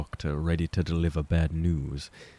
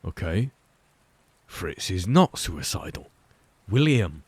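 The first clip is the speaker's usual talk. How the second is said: stern scolding mother. Acted. Clara scolds William.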